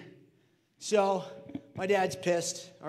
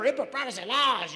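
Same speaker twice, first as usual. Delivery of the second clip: mock-serious fervor; 2 semitones higher than usual. Shown as a demonstration. Showing how the law must be said, with fervor. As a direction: proclaim it like a grave warning. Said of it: "You gotta say it like that with, like, fervor".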